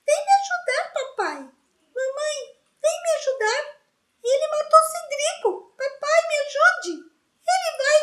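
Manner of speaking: Dudley mocks Harry's nightmare calls in falsetto; mock falsetto whimper. A narrator's reading. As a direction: whine like a scared child calling dad. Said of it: "fez uma voz de falsete e lamúria".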